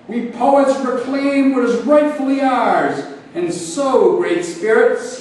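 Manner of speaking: theatrical bellow of a rallying poet. A narrator's reading. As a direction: proclaim it to the open air. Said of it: "he bellowed to the air".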